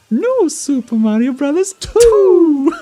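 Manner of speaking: high, loud, slow title call; game title announcement. Retold or imitated. Recalling a quiz clip for a friend, high shouted title impression. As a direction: belt out the title, stretching the ending. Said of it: "trying to remember what happened in it".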